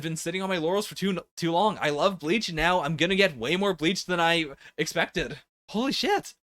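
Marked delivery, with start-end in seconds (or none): 5.7-6.3 rising, amazed disbelief; breathless wonder, pitch climbing; excited. Real, not acted. Stunned reaction to Bleach chapter.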